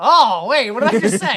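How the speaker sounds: loud, high startled take-back; real surprise. Real, not acted. Host realizes he said something dodgy, loud startled. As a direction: catch yourself mid-blunder. Catches own accidental joke.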